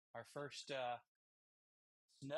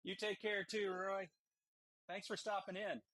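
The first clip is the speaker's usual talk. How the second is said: calling out; 6 semitones higher, 6 dB louder than usual. Real, not acted. Calling goodbye to visitor Roy.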